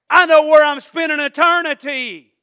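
high, loud preacher's proclamation; triumphant declaration. Real, not acted. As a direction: proclaim it at full voice with certainty. Triumphant declaration at sermon climax.

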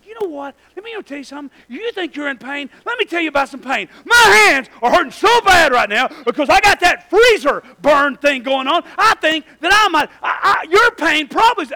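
one-up her labor pains loudly; mock complaining. Husband one-upping his laboring wife, loud mock rant.

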